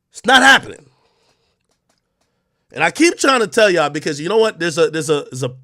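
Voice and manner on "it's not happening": higher and louder, put-on menacing voice; loud mocking threat; mock tough-guy threat. Shown as a demonstration. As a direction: bark it back like a fake gangster. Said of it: "You gotta answer for what you".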